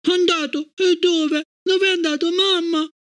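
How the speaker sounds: put-on high, anxious child's questions; a child who cannot believe it; alarmed little girl. A narrator's reading. Gloria questioning her mother, anxious child voice.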